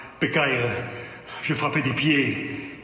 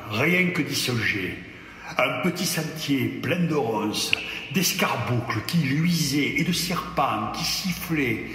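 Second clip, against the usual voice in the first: plain reading voice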